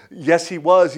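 high, loud answering call; yelling back. Retold or imitated. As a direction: shout the answer up out of a pit. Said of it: "he yells back".

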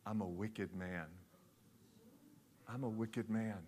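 quiet, low, deadpan admission of wickedness; low wicked man. Retold or imitated. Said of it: "here was the man's response".

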